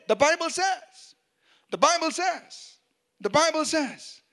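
high, insistent refrain, each repeat alike; firm declaration. Shown as a demonstration. Preacher shows Jesus answering each temptation, high insistent declaration. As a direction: hammer it out as a repeated rebuttal.

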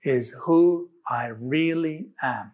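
slow, measured recital for others to join; deliberate group declaration with spaced-out words; leading an affirmation. Shown as a demonstration. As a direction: say it slowly, phrase by phrase. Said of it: "let's say this together".

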